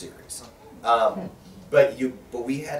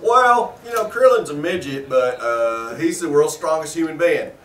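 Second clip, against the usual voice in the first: put-on loud, higher-pitched Texas good old boy; hammed-up good old boy Texas twang; Texas accent; 5 semitones higher, 10 dB louder than usual. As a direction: explain it like a big old Texan director. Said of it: "the Texas accent".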